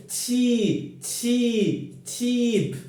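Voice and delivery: "cheap" repeated with a falling tune each time; drilled example word. Shown as a demonstration. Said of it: "adjective with one syllable".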